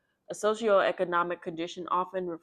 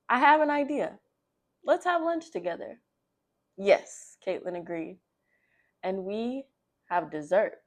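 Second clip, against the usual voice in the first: put-on reading to children; 3 semitones higher, 4 dB louder than usual. Reading a children's story to young viewers, animated storybook voice. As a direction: bring the picture book to life for little listeners. Reads picture book aloud.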